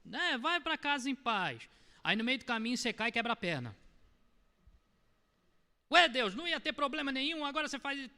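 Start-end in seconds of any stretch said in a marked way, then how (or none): 0.0-1.7 high, syrupy coddling voice, stretched out; hammed-up sickly sweet pity; mock sugary baby talk. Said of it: "falar com amor hoje é você chegar". 5.9-8.1 high, loud indignant outburst at God; a retold rant of betrayal; mock fury. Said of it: "você já levanta num furor".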